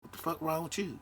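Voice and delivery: high, hushed, affronted; offended disbelief. Retold or imitated. Greeting ignored by a stranger, quietly affronted and high. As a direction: toss it off, stung and incredulous. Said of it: "And I'm like".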